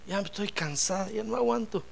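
soft, tired confession; worn-out lament. Acted. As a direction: act out quiet exhaustion. Ana agotada se desahoga. (lamento agotado en voz baja)

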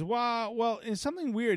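high, puzzled mechanic voice; imitated mechanic. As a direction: mimic a mechanic puzzled by his find. Acts out the mechanic's odd discovery.

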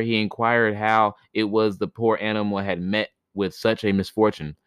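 usual speaking voice

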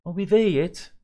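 put-on high, loud impatient child voice; a bored little girl pleading; whiny child. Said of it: "Annie asked again".

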